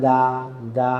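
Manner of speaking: "দা" held long and soft; too soft, drawn out. Shown as a demonstration. Teacher shows the mistake to avoid, "দা" soft and drawn out. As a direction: let each "দা" linger softly. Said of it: "এত softly না".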